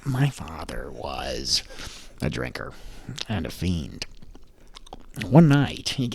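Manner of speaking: very low, slow, unvoiced creepy whisper; Joker whisper. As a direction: lean in and whisper the scar story. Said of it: "I've always been able to do".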